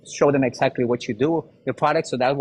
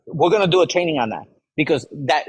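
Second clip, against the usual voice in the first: ordinary delivery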